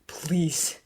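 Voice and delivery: whispered, broken please; begging whisper. A narrator's reading. Severus begging Dumbledore, whispered. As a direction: breathe the word out. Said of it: "Severus begged".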